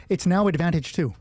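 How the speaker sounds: ordinary talk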